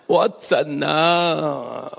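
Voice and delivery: very slow, drawn-out sigh; sighing lament. Acted. Preacher voices God unable to give up, sighing. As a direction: let the question trail out in grief. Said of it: "这不就是何西阿的叹息吗".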